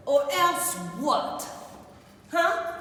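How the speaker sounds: mother challenging her daughter; mother's challenge. Retold or imitated. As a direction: push her, daring an answer.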